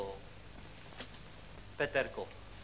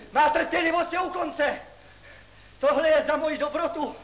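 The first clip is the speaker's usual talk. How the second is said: higher, louder, building outrage; furious yelling; 12 semitones higher, 6 dB louder than usual. Acted. Professor to class after the prank, furious higher louder yelling. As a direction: shout until the voice cracks.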